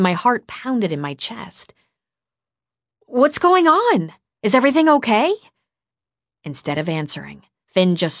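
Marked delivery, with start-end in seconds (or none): 3.1-5.3 high, loud, urgent questions; alarmed questioning. A narrator's reading. Startled girl to the boy at the door, alarmed. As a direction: blurt it out in alarm. Startled, asking after being jolted awake.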